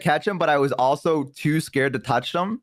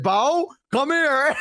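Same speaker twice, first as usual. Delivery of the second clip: high, drawn-out mock holler; calling over; 12 semitones higher, much slower than usual. Dad summoning a troublesome child, imitated drawn-out holler. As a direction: stretch it out like a parent summoning a kid.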